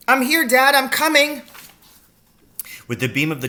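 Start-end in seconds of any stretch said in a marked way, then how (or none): 0.1-1.4 high, loud eager reply; calling back. A narrator's reading. Excited boy answers his father, calling back. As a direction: shout back across the wood.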